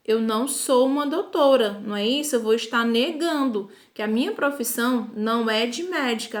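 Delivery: normal talking voice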